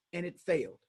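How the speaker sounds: ordinary delivery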